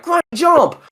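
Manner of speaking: very high, loud cheer; popping-up character impression. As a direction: squeal it out like a random game character popping up. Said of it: "pops up and he's like".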